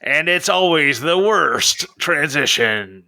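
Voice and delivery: playful mock trailer narrator; movie trailer voice. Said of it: "go with the trailer voice".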